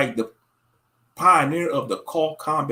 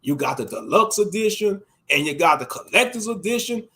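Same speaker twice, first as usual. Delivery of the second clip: normal talking voice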